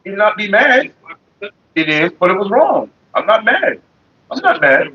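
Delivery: ordinary delivery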